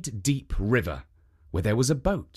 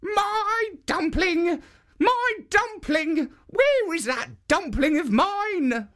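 put-on high, slow calling old woman; high slow granny calling; old woman crying out; slower than usual. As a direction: call after the lost dumpling like a fretful granny.

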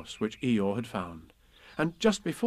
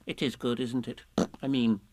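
careless Piglet. A narrator's reading. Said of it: "Piglet said carelessly".

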